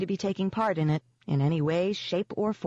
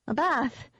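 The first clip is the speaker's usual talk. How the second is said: bright, high question; brightly; 9 semitones higher than usual. A narrator's reading. Rachel covering for Nick, brightly. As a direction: sound cheerful to hide trouble. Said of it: "I said brightly".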